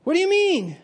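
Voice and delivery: retold crowd shouting in outrage; outraged shout. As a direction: protest in disbelief. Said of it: "people shouted".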